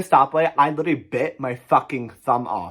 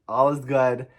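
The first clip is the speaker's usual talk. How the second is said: normal talking voice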